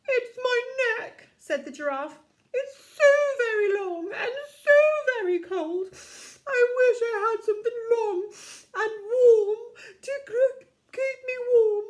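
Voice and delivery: high, loud, whiny posh voice; posh giraffe. Acted. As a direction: plead like a posh shivering giraffe. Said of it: "He's a very posh giraffe".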